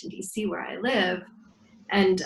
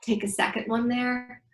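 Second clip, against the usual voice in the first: guided breathing. Acted. Leads a breathing exercise.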